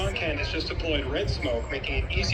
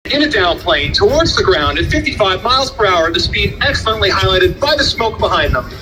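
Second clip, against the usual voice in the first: airshow commentator; 3 semitones higher, 13 dB louder than usual. Acted. Live airshow PA announcing.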